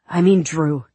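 ordinary delivery, nothing marked

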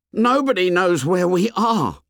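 high, put-on boy's voice; insistent Piggy. A narrator's reading. Piggy tells the boys nobody knows, high and insistent.

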